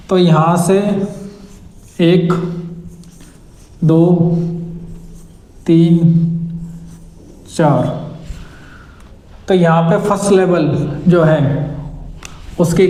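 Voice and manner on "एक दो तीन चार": रुक-रुक कर गिनती, हर अंक अलग; मात्राएँ गिनते हुए धीरे; counting the feet. Shown as a demonstration. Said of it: "इसे जज करोगे". (पैर गिनते हुए रुक-रुक कर)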